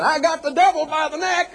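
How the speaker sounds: mock televangelist cry, pitched very high; high-pitched, hammed-up evangelist proclamation; Swaggart. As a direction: imitate a TV preacher boasting at full pitch. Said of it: "here was this guy, Swaggart".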